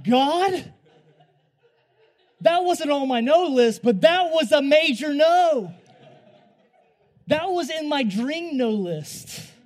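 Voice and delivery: loud, high-pitched mock outrage; hammed-up shouted complaint to heaven, drawing out the ends; outraged protest. Acted outraged protest to God.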